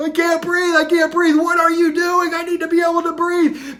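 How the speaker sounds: a man gasping at his mentor for air; panicked pleading. Acted. Panicked after near drowning.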